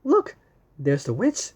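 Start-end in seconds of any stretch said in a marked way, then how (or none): 0.0-0.4 high excited call; 1 excited look. Real, not acted. Parent reading to child, excited look. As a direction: point it out with a burst of delight.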